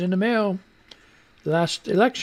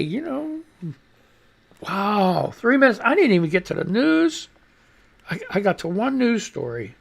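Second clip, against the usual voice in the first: normal talking voice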